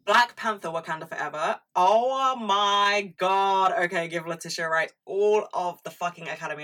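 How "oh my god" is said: awed. Real, not acted. Gushing about Wakanda Forever.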